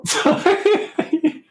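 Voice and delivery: high, squeaky-laughing apology; tertawa. Real, not acted. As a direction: say it through a fit of laughter. Cracks up at the joke.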